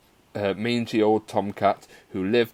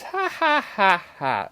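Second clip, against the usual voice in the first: high-pitched mock cackle; cruel girlish laugh; 10 semitones higher, slower than usual. A narrator's reading. Girl laughing as poor kitten suffers, high cruel laugh. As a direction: laugh it out as separate gleeful ha-has. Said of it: "The little girl laughed".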